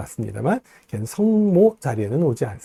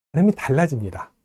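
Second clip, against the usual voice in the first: normal talking voice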